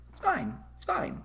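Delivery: high, soft, strained it's fine; forced little it's fine; mock calm. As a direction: squeak it out like everything's falling apart. Repeats it's fine, mocking.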